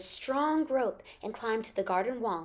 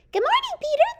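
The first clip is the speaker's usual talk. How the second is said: very high, loud chirpy little bird; happy chirping bird; 7 dB louder than usual. A narrator's reading. Bird greets his friend Peter, happy high chirp. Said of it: "the bird chirped happily".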